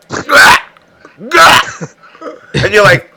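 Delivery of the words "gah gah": loud imitated retching gag; imitating passengers dry heaving on the train; mock dry heave. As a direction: gag out loud like you're about to puke. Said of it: "motherfuckers being on the train going".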